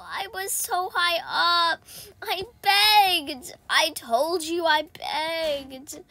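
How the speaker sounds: aggrieved, drawn-out wail; wailing complaint. Real, not acted. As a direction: whine it out, dragging the key words. Laments the fall from high up.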